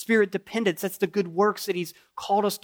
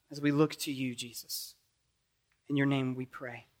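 closing prayer; 4 dB louder than usual. Acted.

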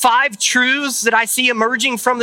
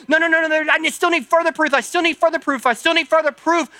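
exasperating clerk; 6 semitones higher than usual. Retold or imitated. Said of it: "they go".

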